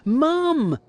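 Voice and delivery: high, drawn-out call for Mum; a boy's high-pitched yell. A narrator's reading. Kipper tricking Mum into coming upstairs, yelling. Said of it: "yelled Kipper".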